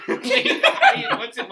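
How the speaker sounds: high loud squawking bird call; ostrich noise. Pretend. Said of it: "could you do, like, an ostrich impression".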